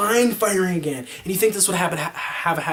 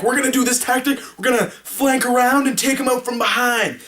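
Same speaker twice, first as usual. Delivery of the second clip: eager plan; 6 semitones higher than usual. Retold or imitated. Retold eager team plan.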